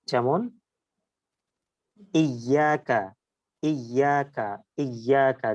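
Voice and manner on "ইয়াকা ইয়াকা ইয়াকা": ইয়াকা with the mid-tongue on the palate; ya demo. Shown as a demonstration. Teacher repeats ইয়াকা for students to watch the tongue. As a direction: press the middle of the tongue up for ইয়াকা. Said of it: "জিহ্বার মাঝখান দেখেন উপরের তালুর সাথে লাগছে".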